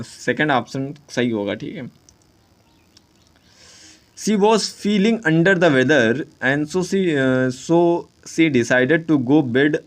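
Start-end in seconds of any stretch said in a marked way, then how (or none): 4.2-9.7 reading the exam sentence aloud slowly; slow question reading. Shown as a demonstration. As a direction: spell out the sentence for the class. (प्रश्न को धीरे-धीरे पढ़ना)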